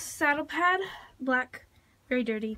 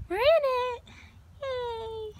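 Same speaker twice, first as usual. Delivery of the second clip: excited cheer; 8 semitones higher, 6 dB louder, much slower than usual. Real, not acted. Cheering on entering the arena.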